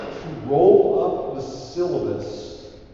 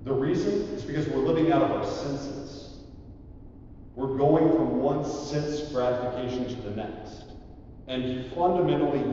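ordinary delivery

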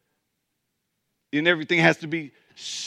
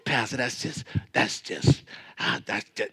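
mock whisper; 6 semitones lower, 7 dB quieter than usual. Mock stuck in conversation.

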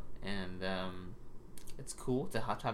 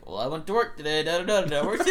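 boring monotone mock of Mike, louder and higher; mock someone telling a dull workday; 5 semitones higher, 7 dB louder than usual. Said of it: "but Mike's always like".

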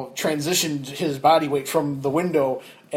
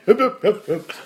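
high, louder half-asleep yeps; mumbling sleepy friend; 7 semitones higher, 5 dB louder than usual. Retold or imitated. Sleeping friend ignoring the driver, sleepy brush-off. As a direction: brush off the shove without waking.

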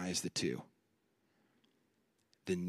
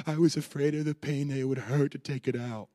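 put-on high, loud, tearful little boy; crying child; 7 semitones higher, 8 dB louder than usual. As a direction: sob it out like a scared kid. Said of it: "through tears, is like".

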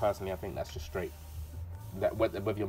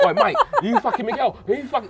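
high, laughing mock rant; mock raging caller; 12 semitones higher, 5 dB louder than usual. Mocking a jealous boyfriend phoning at night, spitting rant. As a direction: ham up a furious caller. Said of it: "all spitting over your phone".

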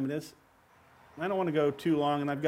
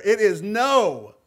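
"It is no." slow and firm, louder, each word weighted; 7 dB louder, slower than usual. Real, not acted. Preacher to congregation about grace: a firm, slow, louder no. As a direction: lay down the "no" as final. Stresses the meaning of no.

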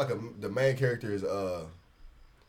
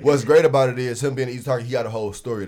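loud and pumped up; selling the idea with energy; excited; 4 semitones higher, 13 dB louder, faster than usual. Real, not acted. Hyped about the character.

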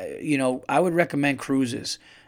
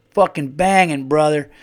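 put-on drunk southern guy; 2 semitones higher, 9 dB louder than usual. Drunk guy answering the comedian while washing hands, loud southern voice. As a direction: shout it out like a happy southern drunk.

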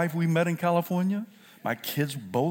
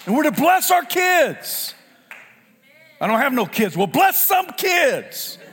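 full-force preaching; 10 semitones higher, 4 dB louder than usual. Acted. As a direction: preach it hard to the congregation. Preacher exhorting parents.

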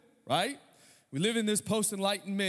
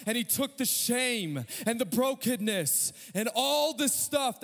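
higher, sung-out pulpit preaching; preaching at full force; 6 semitones higher than usual. Acted. Pastor preaching to his congregation, sung-out and fervent. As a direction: lift the congregation with rising fervour.

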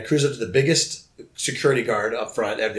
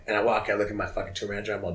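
usual speaking voice

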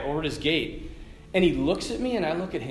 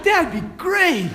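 high, loud, drawn-out delight; joyful; 10 semitones higher, 5 dB louder, slower than usual. Retold or imitated. Fallen man accepting the priest's help, joyful. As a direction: imitate a sweet man's beaming gratitude. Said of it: "not just joyful".

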